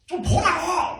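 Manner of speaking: loud, high exasperated shout; emotional yell. Shown as a demonstration. Pastor showing children how feeling enters a shout, exasperated yell. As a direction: act out an outburst of frustration. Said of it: "막 이렇게".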